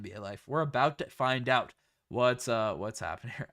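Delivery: usual speaking voice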